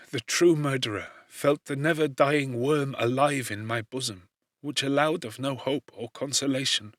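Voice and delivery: ordinary delivery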